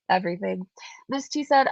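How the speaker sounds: usual speaking voice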